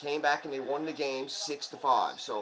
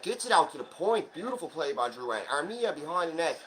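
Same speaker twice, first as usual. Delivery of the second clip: higher, louder running game call; live play-by-play; 5 semitones higher, 4 dB louder than usual. Real, not acted. Fan narrating the hockey game live for viewers, excited play-by-play. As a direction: call every rush as it happens.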